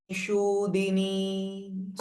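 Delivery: Shudini with a long drawn-out shu, very slow and soft. Shown as a demonstration. Teacher stretching the vowel in Shudini for students. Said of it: "So only shu here is dirgha".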